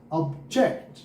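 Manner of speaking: Object said ob-JECT, second syllable punched; stress on second syllable. Shown as a demonstration. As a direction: hit the 'ject' in object. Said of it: "दूसरा जब हो ना syllable पे जोर आए". (Object में 'जेक्ट' पर ज़ोर)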